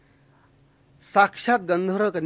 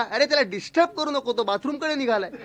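mock shout; 6 semitones higher than usual. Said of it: "तो एकदम ओरडला".

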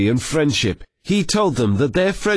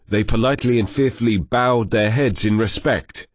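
ordinary delivery

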